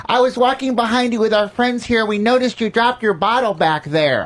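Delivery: high-pitched mock tourist voice; friendly Arkansas tourist. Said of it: "This woman comes up to me from Arkansas".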